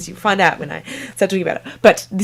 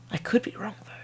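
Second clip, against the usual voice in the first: whisper; 3 semitones higher, 10 dB quieter, faster than usual. Real, not acted. Whispered aside.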